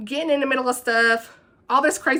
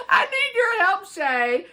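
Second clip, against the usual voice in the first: high-pitched quote through laughter; cracking up mid-quote; 8 semitones higher, slower than usual. Retold or imitated. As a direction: fight the giggles to get the line out. Retake while cracking up.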